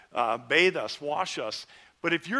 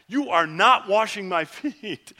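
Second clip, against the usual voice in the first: loud, slow, indignant refusal, higher in pitch; angry elder refusing; 6 semitones higher, 10 dB louder, slower than usual. Retold or imitated. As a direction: bristle like the offended elder, with a laugh breaking through. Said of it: "he was mad".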